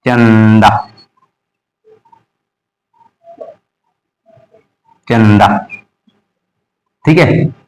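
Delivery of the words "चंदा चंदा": चंदा low, with a lingering nasal; low, careful चंदा stretching the nasal n; mouth then nose. Shown as a demonstration. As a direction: open चं in the mouth, then hum it through the nose. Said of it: "नाक से".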